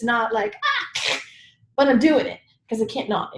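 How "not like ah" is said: laughing. Real, not acted. Laughing mock cheer.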